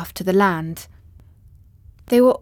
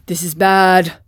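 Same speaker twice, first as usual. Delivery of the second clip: worried Tin Woodman; 5 dB louder than usual. Acted. Tin Woodman voices worry.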